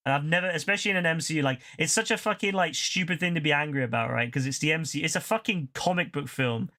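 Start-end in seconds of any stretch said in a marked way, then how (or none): none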